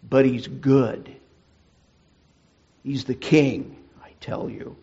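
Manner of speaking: loud, proud Mister Beaver in Narnia; emphatic Mister Beaver. Acted. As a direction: proclaim him king, full of awe. Voices Mister Beaver in the story.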